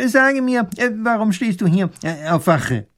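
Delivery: höher, stockend mit vielen Ähs; zerstreuter Professor. Acted. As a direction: ein verwirrter alter Gelehrter sucht die Worte. Professor fragt den Soldaten. (zerstreuter Professor, höher und stockend)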